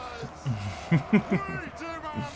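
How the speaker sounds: soft chuckling at his own accent; amused chuckle. Real, not acted. Laughs at own silly French accent.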